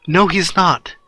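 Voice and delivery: snapping. Acted. Izuku rejecting Ochako's claim about Aizawa, snapping back mildly. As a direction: cut back with a touch of temper. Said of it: "Izuku snapping".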